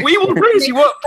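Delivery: high, loud grandiose ad voice; mock slogan. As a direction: proclaim it like a pompous ad campaign. Mocking the ad slogan.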